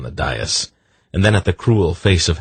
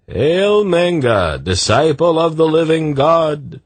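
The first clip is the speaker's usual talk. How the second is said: guardsman's shout; 11 semitones higher, 4 dB louder than usual. A narrator's reading. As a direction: hail the new leader at full voice. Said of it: "one of the mailed guardsmen shouted".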